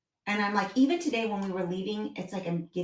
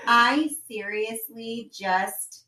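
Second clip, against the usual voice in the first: ordinary talk, nothing marked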